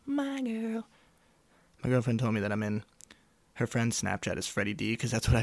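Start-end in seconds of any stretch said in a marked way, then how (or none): 0.1-0.9 put-on "my girl" squeaked way up high; squeaky high. Toying with the nickname his girlfriend uses, high squeak. Playing with the phrase.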